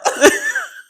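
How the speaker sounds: real high-pitched, loud laugh; high burst of laughter. Real, not acted. Breaking up after a slip.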